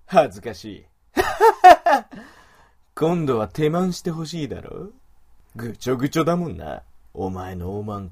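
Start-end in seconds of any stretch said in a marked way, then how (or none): 1.1-2.2 loud high mocking laugh; evil cackle. Wolf man laughs at the moaning woman, loud evil cackle. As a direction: cackle at her shame.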